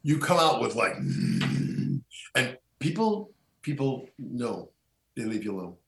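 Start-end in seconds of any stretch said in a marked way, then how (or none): 1.0-2.3 low, drawn-out growl; werewolf growl. Shown as a demonstration. Speaker shows the host the spell's sound, low growl. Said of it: "My students have noticed me growling".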